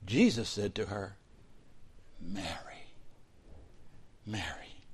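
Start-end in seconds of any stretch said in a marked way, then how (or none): 2.2-4.8 a soft unvoiced whisper of her name; tender whisper. Jesus gently calling Mary by name, whispered. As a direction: whisper the name twice, intimately. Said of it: "Just the way he said it".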